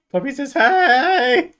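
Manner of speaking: high, loud, drawn-out HEY; exaggerated puppy greeting; playful calling. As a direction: call it out long and silly.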